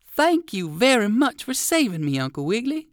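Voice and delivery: relieved little dog; dog thanks the rabbit for saving him; grateful dog. Acted. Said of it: "said the dog".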